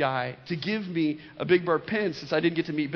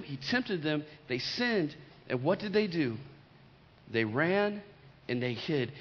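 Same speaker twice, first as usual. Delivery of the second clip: usual speaking voice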